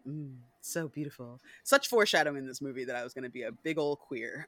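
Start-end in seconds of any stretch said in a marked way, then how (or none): 0.0-1.4 hushed, soft and lower dreamy sigh; dreamy childhood crush. Retold or imitated. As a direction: swoon quietly over him. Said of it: "I used to be like".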